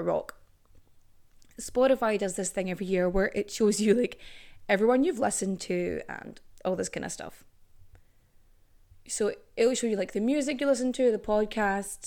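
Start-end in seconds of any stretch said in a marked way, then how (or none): none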